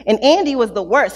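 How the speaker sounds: normal talking voice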